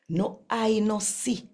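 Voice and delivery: normal talking voice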